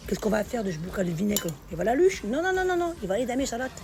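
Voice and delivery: usual speaking voice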